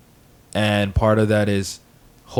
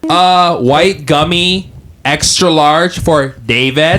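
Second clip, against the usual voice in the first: slow, loud mock counter order, higher; 7 semitones higher, 7 dB louder, slower than usual. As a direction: act out ordering a drink at the counter.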